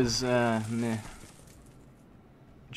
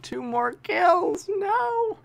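slow high shriek of despair; anguished shout; 8 dB louder, much slower than usual. Real, not acted. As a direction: cry out no in dismay. Reacts to remaining kill count.

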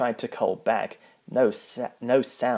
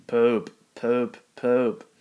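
whistle toot; 2 semitones higher, 4 dB louder, much slower than usual. A narrator's reading. Engine whistle in story.

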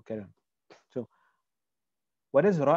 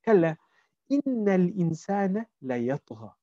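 Quran recitation; 3 semitones higher than usual. Shown as a demonstration. Teacher recites the verse.

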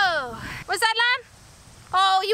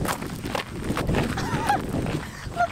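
laughing. Real, not acted. Delighted laugh at found trail.

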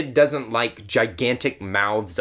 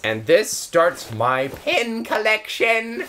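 high, drawn-out showy announcement; playful proud announcement; 9 semitones higher, slower than usual. Real, not acted. Vlogger unveiling a first pin collection, high playful fanfare. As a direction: announce it grandly, pitched up and slow.

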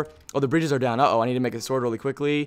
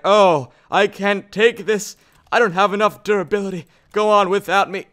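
dramatic dying pick; 5 semitones higher, 6 dB louder than usual. Retold or imitated. Player voicing his broken pickaxe's tragic last words, hammed-up. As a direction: play the broken pick as a melodramatic martyr. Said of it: "he is so dramatic all the time".

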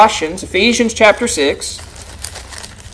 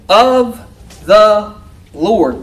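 OF, THE, LORD each set apart with a pause; emphatic; much slower than usual. Shown as a demonstration. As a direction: weigh out each word up to LORD. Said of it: "What's your emphasis on that?".

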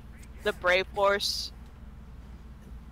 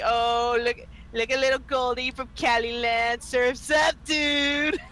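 mock Cali beach boy; surfer dude voice. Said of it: "location jokes".

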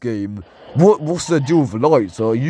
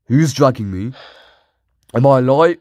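ordinary talk

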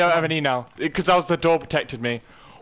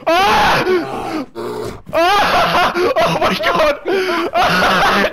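very high, loud screams; panicking gamer; 12 semitones higher, 6 dB louder, much slower than usual. Real, not acted. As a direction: scream in laughing terror. Bear attacking outside the car.

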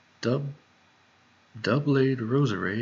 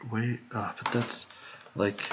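ordinary talk

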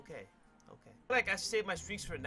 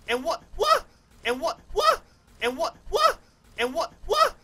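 loud, rhythmic taunt leaping up high; hyped taunting; 9 semitones higher, 10 dB louder, slower than usual. Real, not acted. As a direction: throw it out as a repeated high-pitched challenge. Taunts repeatedly after a kill.

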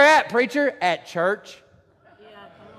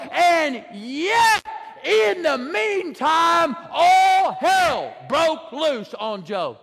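higher and shouted, slowing down at the end; full-force preaching; 5 semitones higher, slower than usual. Acted. Pastor preaching Job's story to the church, building to a shout. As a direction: hammer each line home from the pulpit.

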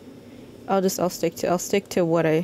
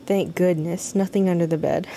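ordinary talk

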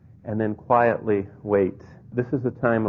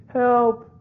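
mock scream for help; 13 semitones higher than usual. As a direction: cry out "Help" in a high mock panic. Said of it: "that's what he's just screaming out".

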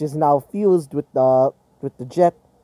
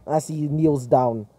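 plain reading voice

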